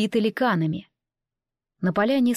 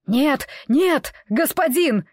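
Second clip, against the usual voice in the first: yelling; 6 semitones higher, 3 dB louder, slower than usual. A narrator's reading. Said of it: "во всё горло заорал Атти".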